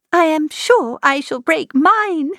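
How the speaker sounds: put-on high, dramatic young girl's sigh; girlish lament. A narrator's reading. Lydia voiced, lamenting.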